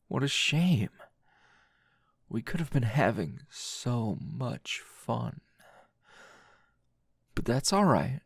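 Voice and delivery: intimate, teasing roleplay voice, close to the mic; seductive tease. Acted. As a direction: lean in and toy with your captive.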